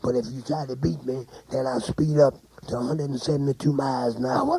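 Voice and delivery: ordinary delivery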